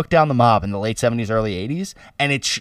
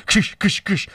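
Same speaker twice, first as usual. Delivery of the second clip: quick kush-kush chopping noises; imitated chopping sounds; 5 semitones higher, slower than usual. Describing Nonna cutting pasta, imitated chopping. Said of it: "it's just like".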